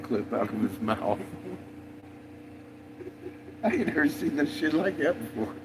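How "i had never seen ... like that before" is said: normal talking voice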